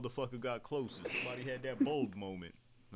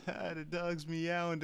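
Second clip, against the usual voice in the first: higher, very slow dazed wonder; stoned disbelief; 7 semitones higher, much slower than usual. Retold or imitated. Retelling a stoned teen moment, slow dazed wonder. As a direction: drag it out like you can't believe it.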